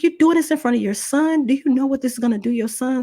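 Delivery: high, urgent and disbelieving; indignant challenge. Retold or imitated. Woman confronts attacker before his son, indignant high protest. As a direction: shame him with a pressing question.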